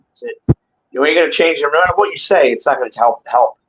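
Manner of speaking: ordinary talk, nothing marked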